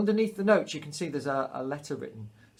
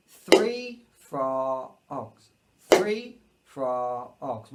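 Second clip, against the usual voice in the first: held three frogs; 4 dB louder, much slower than usual. Shown as a demonstration. Teacher holding the dotted minim as three frogs. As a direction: stretch three frogs over three beats. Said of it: "So a dotted minim".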